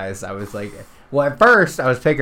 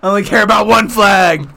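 louder, higher fierce American rant; patriotic rant; 8 semitones higher, 10 dB louder than usual. Retold or imitated. Fiancée insisting she only knows her flag, loud rant. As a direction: rant like a proud flag-lover. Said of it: "She said".

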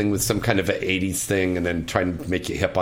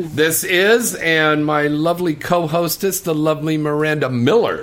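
louder announcer voice; radio host intro; 5 semitones higher, 4 dB louder than usual. Acted. Host introducing his co-hostess to the caller, announcer voice. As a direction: introduce the co-host with showbiz flair.

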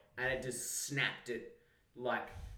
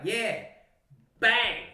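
higher, loud burst; triumphant payback; 7 semitones higher, 8 dB louder than usual. Retold or imitated. Telling how he got his brother back, triumphant. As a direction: punch it out like a gleeful shove.

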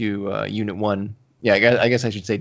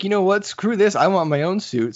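higher, mock-exasperated outburst; fed-up pilot; 8 semitones higher than usual. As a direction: voice a pilot who is done waiting. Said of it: "so Layla's like".

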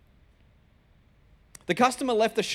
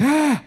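high, loud mock "ah" of dread; yelped, hammed-up "ah"; alarmed gasp; 7 semitones higher, 7 dB louder than usual. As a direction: cry out in comic panic. Retold alarm at boss's words.

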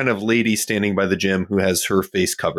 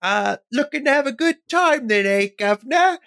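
very high, put-on lady of the night; badly disguised lady of the night, pitched way up; Ebby posing as streetwalker. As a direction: proposition a passerby in a squeaky, hammed-up voice. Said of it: "Ebby is going to respond and say".

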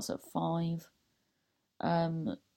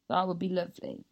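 normal talking voice

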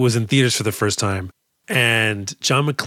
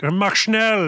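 higher-pitched mimicked actor's voice; Alan Rickman; 8 semitones higher than usual. Fan mimicking the actor's lines, same high put-on voice. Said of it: "It's the same fucking voice".

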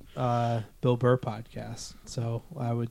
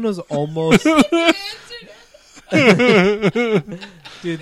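laughing hard; 8 semitones higher, 8 dB louder, much slower than usual. Real, not acted. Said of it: "almost a fucking story time".

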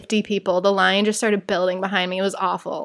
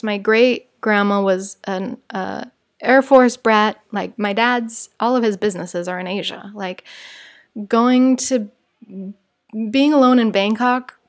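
usual speaking voice

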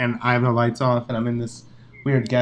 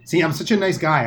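ordinary delivery

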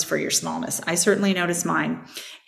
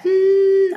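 much higher, louder hum in the head; 14 semitones higher, 5 dB louder than usual. Shown as a demonstration. Showing viewers humming in the head, high hum. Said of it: "If I'm humming in my head".

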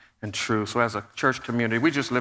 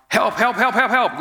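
high, loud cries of help; yelling for help; 11 semitones higher, 6 dB louder than usual. Retold or imitated. Trapped people yelling for help in the stuck elevator. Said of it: "some of the group started yelling out".

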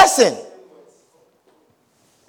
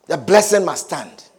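usual speaking voice

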